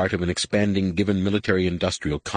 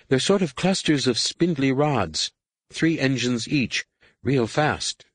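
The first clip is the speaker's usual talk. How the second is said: lightly voiced, higher character reporting what she sees; unsure lookout; 6 semitones higher, slower than usual. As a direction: pitch it up, feeling out each description. Voices Tahiri reading the sensors.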